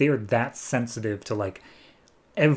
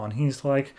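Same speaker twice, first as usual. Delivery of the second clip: ordinary talk, nothing marked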